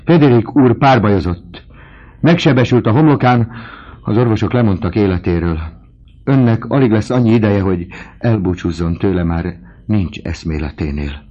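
loud, high-pitched alarmed report; servant crying out. A narrator's reading. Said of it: "kiáltotta".

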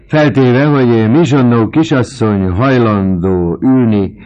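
loud, high mocking jest; mock the old spinster. Vautrin mocks Michonneau in front of the table.

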